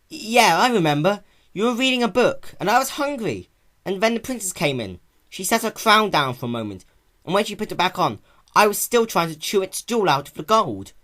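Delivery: put-on high, loud boyish voice; a bashful kid remembering; lively young dragon recalling.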